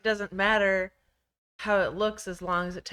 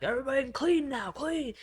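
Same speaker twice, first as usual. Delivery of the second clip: mock angry dad; 8 semitones higher than usual. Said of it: "and be like".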